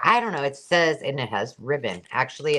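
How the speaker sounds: ordinary delivery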